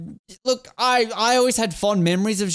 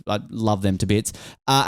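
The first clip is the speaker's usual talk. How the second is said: normal talking voice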